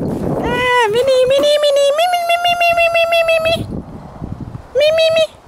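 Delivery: high, loud sing-song call to a cat. Real, not acted. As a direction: call her name up high across the garden. Calling the cat in garden.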